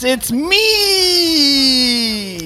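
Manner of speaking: high, gleeful squeal; gleeful shriek. Retold or imitated. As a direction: shriek it out in triumph. Gleeful punchline of mocked official.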